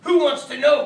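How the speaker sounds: loud gruff challenge; growled. Acted. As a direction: growl it at the stranger. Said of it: "growled a voice from inside".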